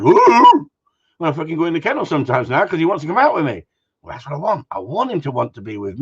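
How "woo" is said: high, loud excited woo; dog's excited woo. Retold or imitated. Dog whooping to be let out of the kennel, loud high woo. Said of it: "He goes".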